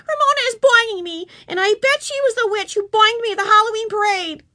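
put-on high, whiny complaining girl; near tears. A narrator's reading. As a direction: tattle to the teacher, close to crying. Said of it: "on the verge of tears".

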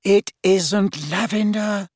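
high, slow, exasperated; impatient. A narrator's reading. Hermione corrects Ron as he hides, impatient. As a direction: spell it out impatiently. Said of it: "said Hermione impatiently".

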